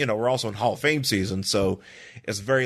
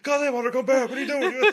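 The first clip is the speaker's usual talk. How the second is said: high hammed-up Steve Austin shout; Steve Austin impression. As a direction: call after a fleeing friend, hammed up. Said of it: "Steve Austin's like".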